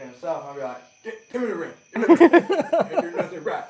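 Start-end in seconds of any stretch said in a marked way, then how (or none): 1.0-2.3 put-on high, rising to a loud crotchety old man. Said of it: "I tried to say it in an old man voice". 2.8-3.6 put-on fast, loud, laughing crotchety old man. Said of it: "I tried to say it in an old man voice".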